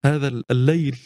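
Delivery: ordinary delivery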